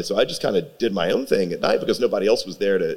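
usual speaking voice

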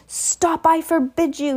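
high, urgent cry to halt; panicked yell. A narrator's reading. Uncle trying to silence the giant, panicking. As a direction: cut in with a high-pitched, panicky protest. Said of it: "yelled Uncle Vernon in panic".

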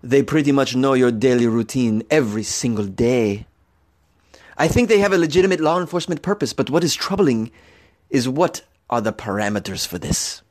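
put-on sexy man's voice; Raul. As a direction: play up a seductive character voice for the quote. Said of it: "Raul would talk like this".

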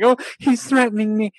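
high-pitched mock alarm; acting threatened. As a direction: imitate someone faking fear. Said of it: "trying to act like she was being threatened".

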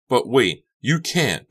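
high, loud protest; alarmed teen objection; crying out. A narrator's reading. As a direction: cry out in disbelief. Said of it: "Becca cried".

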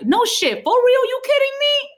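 high, loud disbelief; incredulous question. Shown as a demonstration. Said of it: "like, a question mark".

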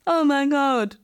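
lightly slurred, muffled oh my God; muted drugged voice. Retold or imitated. New mum imitating her anaesthetised voice, muffled and muted. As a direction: sound dulled by the anaesthetic. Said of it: "talking like this, like, muted".

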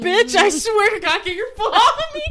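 high, loud laughing protest; laughing shriek. Real, not acted. Friend's foot on her.

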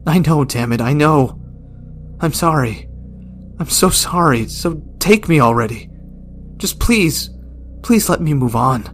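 put-on desperate old man shouting; anguished pleading shout. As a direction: cry out for release. Gerald begs, anguished.

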